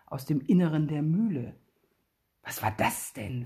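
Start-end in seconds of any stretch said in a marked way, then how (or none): 2.4-3.4 timid, quiet worried question; hushed nervous boy asking in the dark; fearful. A narrator's reading. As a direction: ask it softly, a little scared. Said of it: "fragt Billy ängstlich".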